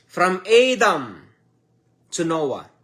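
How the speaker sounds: slow, drawn-out words with a pause before "to Noah"; said slowly. Shown as a demonstration. Preacher repeating the Bible sequence for learners, slowly. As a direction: spell out the sequence slowly, phrase by phrase. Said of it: "I'm gonna say it slowly".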